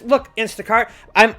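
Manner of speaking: ordinary talk, nothing marked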